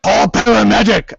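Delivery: rough monster growl calling for help; guttural puppet-monster plea; Cookie Monster. Acted. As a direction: growl out a mock emergency. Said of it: "having to do a Cookie Monster voice".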